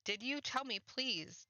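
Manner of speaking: high, slow begging question; pleading. Acted. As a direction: draw out the plea, voice lifted. Pleading for the truth.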